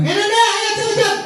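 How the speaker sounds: high eager teacher. Shown as a demonstration. Teacher eagerly urging Nanda to answer, high bright voice. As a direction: call the pupil by name, bright and eager.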